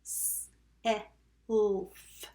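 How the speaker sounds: "S, E, L, F" as separate sounds, hissed s; sounded out. Shown as a demonstration. Teacher tapping self with pupils, sounded out. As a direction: isolate each sound of "self". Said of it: "Tap self".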